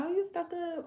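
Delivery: high, snippy mock of the gossips; mocking accusation. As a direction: toss it off like a judgy onlooker. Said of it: "people be thinking, like".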